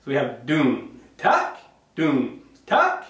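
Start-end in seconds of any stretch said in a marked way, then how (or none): 0.5-1.0 dum dropped low and falling; low deep dum. Shown as a demonstration. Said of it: "It's a low, deep sound". 2.7-3.0 tak leapt up very high. Shown as a demonstration. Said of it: "Tak is high-pitched".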